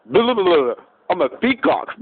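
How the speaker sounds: a peacock brushing off the monkey, high and mocking; taunting peacock. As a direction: brush him off with a sing-song taunt.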